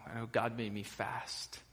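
a hint of a Scottish accent, fading soft at the end; light Scottish accent. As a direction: quote the film line in a touch of Scottish brogue. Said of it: "You heard my Scottish accent come out a little bit there".

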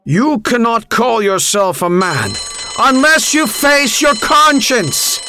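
slow, high, building proclamation; shouting over noise. A narrator's reading. Teacher summing up the lesson over the bell, building shout. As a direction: raise the pitch steadily as if outshouting a bell. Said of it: "he had to outshout the bell".

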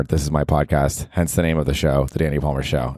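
ordinary delivery

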